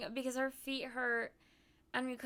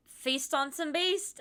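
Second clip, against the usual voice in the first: slow, louder, hammy delivery; goofy slogan voice; 4 semitones higher, 8 dB louder, slower than usual. Retold or imitated. Friend suddenly quoting the candy bar, hammed up. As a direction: proclaim it like an ad tagline. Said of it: "out of nowhere, she went".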